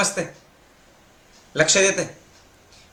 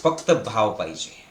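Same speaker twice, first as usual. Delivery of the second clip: ordinary talk, nothing marked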